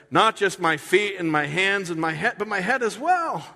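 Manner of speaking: high, loud overeager plea; eager Peter. Retold or imitated. Peter flipping to beg Jesus for a full wash, high and loud. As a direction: gush like he wants it all at once. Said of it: "Simon Peter replied".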